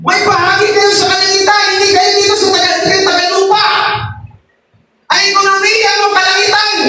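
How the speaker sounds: high, ringing scripture proclamation; fervent preaching. Real, not acted. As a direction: proclaim it urgently to the congregation. (masiglang pagpapahayag ng salita, mataas ang tono)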